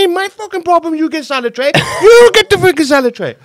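put-on angry father refusing to fetch the salad tray, Indian accent; Indian accent dad. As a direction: throw the blame back in a dad's Indian accent. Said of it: "he gets more and more Indian".

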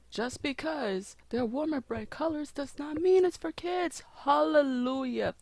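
relieved excitement. Real, not acted. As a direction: burst out with happy relief, pitch climbing. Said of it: "that was a huge relief for me".

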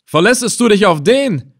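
loud, high, derisive questions; scornful challenge. A narrator's reading. Captor scoffs at prisoner's hoped-for rescuer, scornful challenge. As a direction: challenge his hope of rescue.

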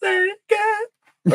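high, slow, drawn-out echo; sing-song echo. Acted. Echoing his friend in a high, slow, teasing voice.

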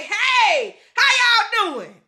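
bright, slow, friendly hello with a smile; cheerful greeting. Pretend. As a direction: greet the room like a cheerful person. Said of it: "smile and say".

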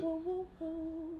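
very soft, slow cooing; hushed little comforting woos; soothing coo. Real, not acted. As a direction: coo gently to comfort your partner. Cooing to comfort upset partner.

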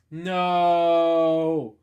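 a cub's loud anguished wail. Acted. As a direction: cry it out over his father. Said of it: "Simba wailed".

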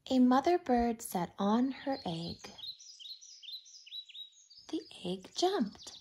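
ordinary delivery

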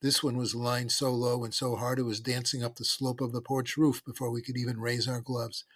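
usual speaking voice

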